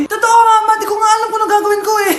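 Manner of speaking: loud, high and rushed panic; panicky child. Acted. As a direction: blurt it out, desperate and fast. Child panicking over crying baby. (nagpapanic na anak, malakas at mabilis)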